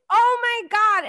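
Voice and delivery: high, loud thrilled oh my God; excited gasp. Retold or imitated. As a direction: shriek it with delight. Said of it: "I was like".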